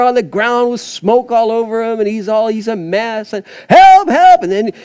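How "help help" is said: high, loud desperate cry for help; a son being electrocuted shouts to his father, loud and high; crying out for daddy. Retold or imitated. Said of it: "and he's crying".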